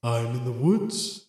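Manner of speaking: very slow, low, soft eerie voice; slow, hushed mystery voice; disembodied voice. Acted. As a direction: let it float out quietly from the unseen. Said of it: "Disembodied voice, where are you?".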